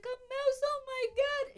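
very high wailing mom imitation; mock sobbing. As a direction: squeal it like a hysterical, sobbing mother. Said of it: "And she was sobbing".

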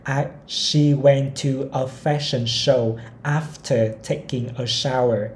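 each word of the sentence spaced out slowly; slow model reading. Shown as a demonstration. Teacher reading a model sentence to learners, very slowly. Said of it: "sorry, cái after có âm F".

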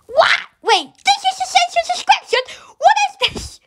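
high-pitched incredulous outburst; shocked disbelief. Real, not acted. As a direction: squeal it in disbelief. Shocked reaction to the game.

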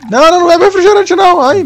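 fast, high panicked yelling; panicked shout. Real, not acted. Panics as item gets used.

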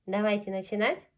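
plain reading voice

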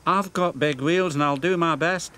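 Emily. Acted. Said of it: "said Emily".

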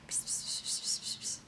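soft pss pss to call a cat; whispered cat call. Real, not acted. Calling cat softly.

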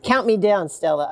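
plain reading voice

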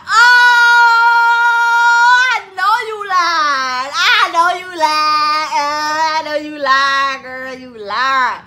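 loud, high, sing-song and teary; teary excitement. Retold or imitated. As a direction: cry it out, overjoyed and accusing. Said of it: "she like, I'm like".

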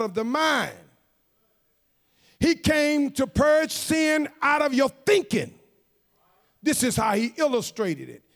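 emphatic sermon, key words punched; forceful preaching. Real, not acted. Preacher tells congregation Christ came to purge sin, forceful preaching. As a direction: proclaim it to the whole church in measured phrases. Preacher drives home the teaching.

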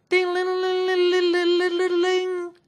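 high, loud ringing bell; shrill alarm bell sound; imitated alarm bell. A narrator's reading. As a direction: ring it out like an alarm.

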